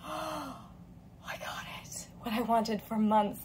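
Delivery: breathless whispered joy; awed hushed whisper; stunned whisper. Retold or imitated. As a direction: whisper it in awe. Gasps at getting the backpack.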